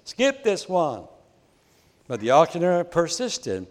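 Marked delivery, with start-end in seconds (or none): 0.1-1.0 high-pitched heckling call from the back; mild mock shout. A narrator's reading. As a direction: call out impatiently, wanting the good stuff. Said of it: "a voice in the back of the room shouted".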